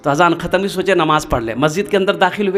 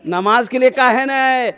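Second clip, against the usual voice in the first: insistent rebuke; 3 semitones higher than usual. Acted. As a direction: press the same question harder, stretching the end. Holy man repeats the rebuke, insistent. (खींचकर ज़ोरदार डाँट भरा सवाल)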